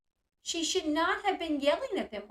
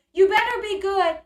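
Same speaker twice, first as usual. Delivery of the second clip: threatening yell; 6 semitones higher, 9 dB louder than usual. Retold or imitated. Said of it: "I think he says".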